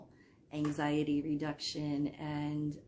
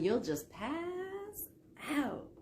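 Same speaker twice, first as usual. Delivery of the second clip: high, drawn-out singsong tease; playful teasing; 11 semitones higher, slower than usual. Pretend. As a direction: stretch it out slowly, way up high. Teasing a listener about falling asleep.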